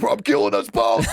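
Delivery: hammed-up maniac shout; crazed mock shout. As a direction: shout it like a joke madman. Acted crazed threat while driving.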